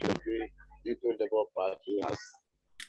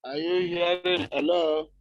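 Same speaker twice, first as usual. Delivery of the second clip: higher, louder hello down the line; calling out; 7 semitones higher, 5 dB louder than usual. Real, not acted. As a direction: call out to be heard. Caller can't be heard.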